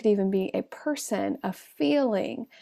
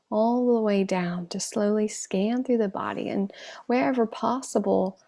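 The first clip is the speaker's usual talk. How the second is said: unhurried meditation voice with long pauses; guided meditation. Acted. Teacher guides online class to scan the body, calm meditation voice. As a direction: settle them gently into stillness, leaving space between phrases.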